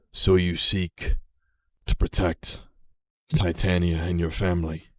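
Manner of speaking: lightly voiced ancient dragon, slow and measured; a hint of a wise old dragon questioning; dragon npc voice. The game master voicing a dragon to Lily, lightly measured.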